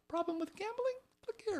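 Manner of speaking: high, soft squeaky voice for the fine print; mock tiny-print voice. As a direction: make it sound tiny and unimportant. Mocking tiny website notice.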